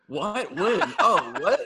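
high-pitched mock confusion; playing clueless. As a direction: imitate someone acting clueless, voice lifted. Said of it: "He was clueless".

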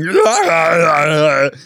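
mock action-hero yell; hammed-up loud movie-star shout; Arnold Schwarzenegger yell. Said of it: "it's gonna be Arnold Schwarzenegger going".

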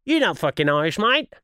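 imitated Australian disbelief, rising on the last word; put-on Australian twang; mock Australian accent. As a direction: challenge it like a doubting Australian. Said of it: "And they're like".

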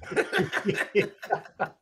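high unvoiced burst of laughter; loud laugh. Real, not acted. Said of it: "This is me laughing".

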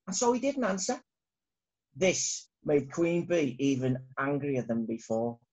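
ordinary delivery, nothing marked

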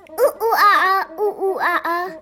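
put-on giggly child hooting like a monkey and quacking; duck and monkey noises. Child pretending to be a duck and monkey, playful hoots. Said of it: "Reva pretended to be a duck and a monkey".

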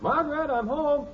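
high-pitched homecoming call. Acted. Husband announcing himself to wife on arrival, high call. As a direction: sing out for someone in another room.

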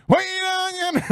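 high-pitched imitation yell; sudden high mock holler; mimicked video shout. Acts out video's opening line.

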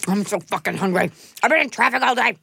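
talking while eating; words around a bite of food; mouth full. Real, not acted. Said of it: "I have to stop eating".

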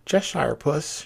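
a touch of timidity, voice lifted high. A narrator's reading. Alice first addressing the Cheshire Cat, timid. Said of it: "she began rather timidly".

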